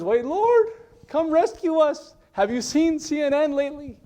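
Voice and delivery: high, loud panicky wail; hammed-up begging for rescue; mock pleading. Preacher imitates believers begging the Lord, mock desperate.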